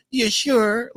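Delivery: a touch of put-on doubt; Elder Mother. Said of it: "Like, Elder Mother".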